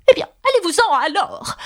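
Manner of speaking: high, grumpy pigeon; sulky bird sending someone away; sullen grumble. A narrator's reading. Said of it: "grommela le pigeon d'un ton maussade".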